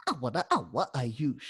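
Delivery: Awa, Awa with a choppier scratch, then Ayush; other scratch pattern. Shown as a demonstration. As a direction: cut the scratch shorter. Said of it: "That's the other scratch pattern".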